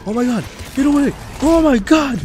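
very high, loud and rising in panic; real high-pitched alarm; panicked yelling. Real, not acted. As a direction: scream at it to back off. Attacked by enemy in game.

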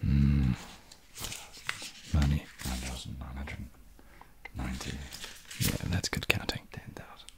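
hushed, savouring count of money; soft greedy counting under the breath; gloating whisper. Acted. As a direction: whisper the numbers like a miser relishing each bill.

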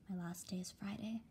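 unvoiced whisper; hushed. Dad tells the family he was laid off, whispered. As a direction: barely get it out.

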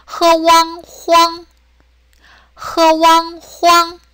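흐왕, 황 held high and level; first tone. Shown as a demonstration. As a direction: sustain 황 on one steady note. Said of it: "일성 그대로".

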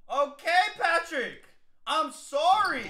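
loud, high apology yelled at the screen; shouted apology. Real, not acted. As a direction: yell your apology to the player.

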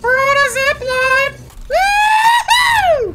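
high, slow, thrilled cheer; excited whoop. Real, not acted. Gamer cheering as he rides the zip line.

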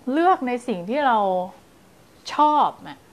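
normal talking voice

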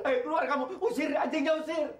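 high-pitched, upset command; distressed order. Acted. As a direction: order them out while still smarting. Bitten boss orders dog chased away.

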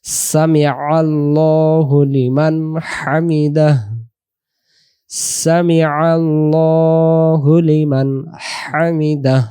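slow, chanted Arabic recitation; slow recited Sami আল্লাহু লিমান হামিদাহ. Shown as a demonstration. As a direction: intone it slowly like in prayer. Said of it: "হায়ের উচ্চারণটা ভেসে উঠাইতে হবে".

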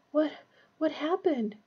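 halting, faint groan; groggy groan. A narrator's reading. Ron waking at the end of the ward, groaning. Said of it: "he groaned".

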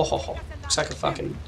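ordinary delivery

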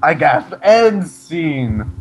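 big hammed-up curtain line; mock-dramatic finale. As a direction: announce the ending with ham. Repeats cliffhanger as mock finale.

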